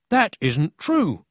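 loud, slow protest; the captain bursts out against the thief; indignant cry. A narrator's reading. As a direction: slam the lie down word by word. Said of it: "cried the captain".

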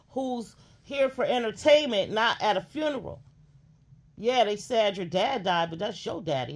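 fired-up call-out at full volume; heated rant. Real, not acted. Ranting about crying on panel.

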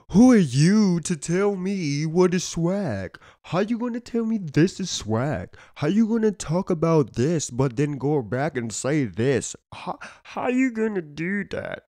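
high, slow mocking whine of an offended commenter; mocking whiny commenter. Mocking insecure commenters in a high whiny voice. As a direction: stretch it out high like a butthurt hater.

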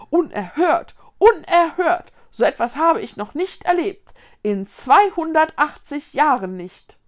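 put-on emphatic huff of an offended old tree; indignant outrage. A narrator's reading. Tree scolds the troublemakers. (lautstark empört)

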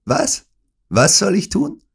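villain mocking the whisper; mock surprise. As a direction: feign not understanding. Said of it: "fragte Smith".

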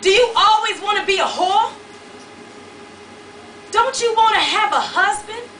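high, loud frantic shouting. A narrator's reading. Woman shouting at the man beating on her door. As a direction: yell him away from the door. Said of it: "he started shouting".

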